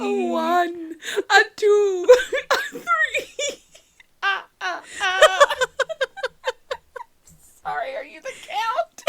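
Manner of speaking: giggling through the words; can't stop laughing while talking. Real, not acted. Laughing through a mock count.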